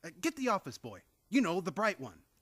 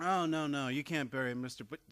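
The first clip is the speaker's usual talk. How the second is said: ordinary talk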